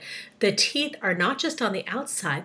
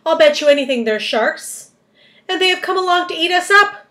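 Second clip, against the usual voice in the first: high, loud panicky worm voice; frightened earthworm; 8 semitones higher, 8 dB louder than usual. A narrator's reading. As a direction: cry out in alarm. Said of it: "cried the earthworm".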